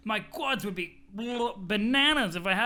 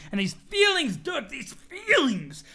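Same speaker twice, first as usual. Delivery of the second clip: overwhelmed; 7 semitones higher, 4 dB louder than usual. Acted. Bro overwhelmed by feelings.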